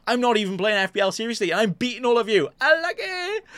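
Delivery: smug, rising gloat. Pretend. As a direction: taunt the other players. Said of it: "be like".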